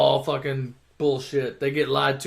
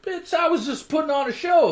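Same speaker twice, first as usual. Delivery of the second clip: [name] impression; 10 semitones higher than usual. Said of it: "And [name] is like".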